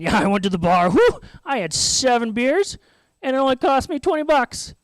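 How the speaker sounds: loud, high mock bragging; boastful bar-goer. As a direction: whoop it up about a wild weekend. Imitating boastful weekend bar-goers.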